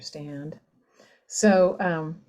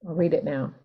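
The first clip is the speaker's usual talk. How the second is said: usual speaking voice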